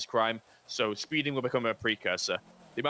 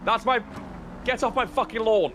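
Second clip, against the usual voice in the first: loud, high mock rant at intruders; grumpy old man yell; 11 semitones higher, 6 dB louder than usual. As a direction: bark it like a cranky old man guarding his lawn. Mocking a grumpy neighbour.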